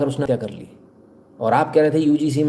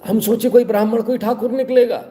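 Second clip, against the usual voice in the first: sarcastic mock surprise; 9 semitones higher than usual. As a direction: play it as a fake gasp of disbelief, pitched high. (व्यंग्य भरा नकली आश्चर्य, ऊँची आवाज़ में)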